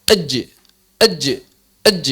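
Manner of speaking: ej with hard stop; jim collision. Shown as a demonstration. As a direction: strike the ej firmly. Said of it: "seperti benturan". (jim dengan benturan)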